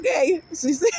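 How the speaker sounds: high squeaky words through real laughter; barely getting words out for laughing; laughing hard. Real, not acted. Retelling the friend's piano story, cracking up with real laughter. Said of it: "okay, I gotta breathe".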